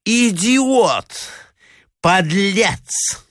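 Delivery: high-pitched cries of abuse; angry shouting. A narrator's reading. Hostess abusing the man who betrayed her, crying out angrily. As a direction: hurl each insult high, one at a time. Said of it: "кричала Марья Александровна".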